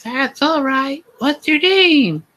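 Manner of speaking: put-on falsetto, slow, inquisitive girl voice; high girly questioning. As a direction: ask in a squeaky teen-girl voice. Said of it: "I questioned".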